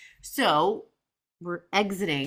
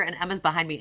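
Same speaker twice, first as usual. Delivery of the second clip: usual speaking voice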